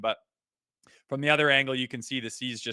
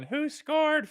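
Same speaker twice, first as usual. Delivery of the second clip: high excited call from bed; yelling. Retold or imitated. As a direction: imitate a kid's high-pitched yell. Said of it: "he's yelling".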